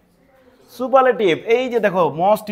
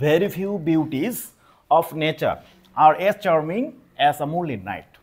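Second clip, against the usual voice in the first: slow dictation reading; 4 semitones lower, much slower than usual. Shown as a demonstration. Teacher reading board example aloud.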